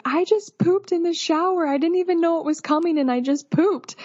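imitating an upset client, pitched high; retold scared embarrassment. Client confessing to her postpartum caregiver, mildly imitated embarrassed distress. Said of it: "crying and so embarrassed. ... and also scared".

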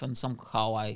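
normal talking voice

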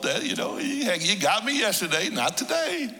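high, laughing mock protest; laughing retort. Preacher teasing God about the repeated question, laughing and high. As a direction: tease God playfully.

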